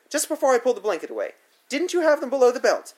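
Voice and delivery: fast, high, loud accusing; furious accusation. A narrator's reading. Sitter accusing the boy, high fast angry. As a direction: rush the accusation at him.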